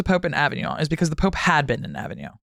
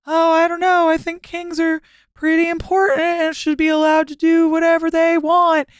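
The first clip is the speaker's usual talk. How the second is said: much higher put-on voice, coy and noncommittal; mock French pope; 13 semitones higher, 3 dB louder than usual. Podcaster imitating the French pope courting kings, much higher mock voice. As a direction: pitch it way up like a pope hinting at favours. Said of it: "make some noises about being like".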